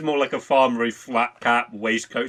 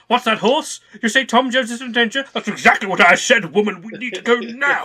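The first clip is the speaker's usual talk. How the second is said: hammed-up hero; 11 semitones higher, 6 dB louder than usual. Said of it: "It's like".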